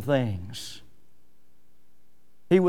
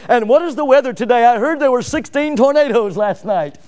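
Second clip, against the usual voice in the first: bright fast chirpy anchor voice, louder; mock grinning newscaster; 5 semitones higher, 6 dB louder than usual. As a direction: parody a TV anchor with a pasted smile. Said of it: "they'll be grinning and smiling".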